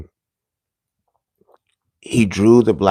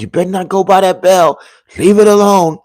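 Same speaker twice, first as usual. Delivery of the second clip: high-pitched grandmother voice, stern; grandma warning; 10 semitones higher, 5 dB louder than usual. Retold or imitated. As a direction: mimic grandma waving you off the bell, pitched up high. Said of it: "My grandmother said".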